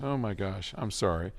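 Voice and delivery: ordinary talk, nothing marked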